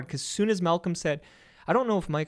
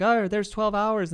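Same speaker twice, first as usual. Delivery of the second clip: mock announcer; 7 semitones higher, 4 dB louder than usual. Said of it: "you kill it, like".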